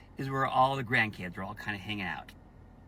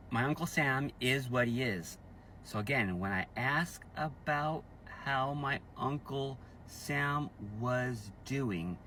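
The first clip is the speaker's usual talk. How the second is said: ordinary talk, nothing marked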